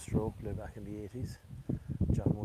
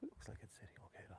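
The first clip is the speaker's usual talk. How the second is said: hushed whisper while the shot is played; golf commentator whisper; 15 dB quieter than usual. Real, not acted. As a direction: whisper so as not to disturb the player.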